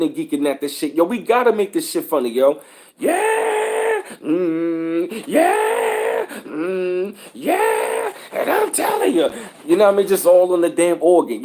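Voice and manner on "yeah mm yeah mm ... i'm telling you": mock preacher shouting. Comedian mocks the church organist, wailing and shouting. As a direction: ham up a wailing preacher's yeahs. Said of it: "yelling and screaming and shit".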